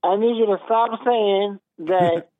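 normal talking voice

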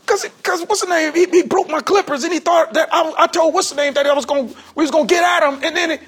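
high, loud, aggrieved account; retold worked-up explanation. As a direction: shooter justifying himself over broken clippers. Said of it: "he was like".

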